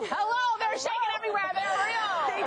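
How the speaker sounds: high, emphatic comic insistence; exaggerated high-voiced exclamation; mock incredulous. Host mocks the obvious answer.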